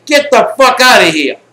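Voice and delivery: loud, high-pitched retold yell; imitated yelling. Bride yells at the intruding friend, imitated angry yelling. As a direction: act out her yelled order to leave. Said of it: "she starts yelling at me".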